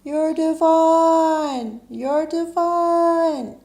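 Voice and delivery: very high, loud, long-held call; booming high-pitched yell with stretched vowels; God's mountaintop yell. Acted. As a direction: holler it down from a mountaintop, very slowly. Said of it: "God stands on a mountaintop and yells".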